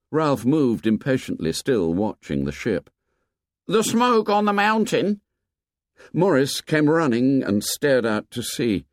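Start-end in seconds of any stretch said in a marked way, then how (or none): 3.7-5.2 put-on higher, curt boy's answer; impatient boy. A narrator's reading. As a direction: snap it out impatiently.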